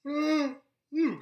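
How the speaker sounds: high loud yawn breaking into speech. Real, not acted. Mid-sign-off to viewers, a sudden loud yawn. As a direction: let a big yawn escape. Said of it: "I had a, a yawn".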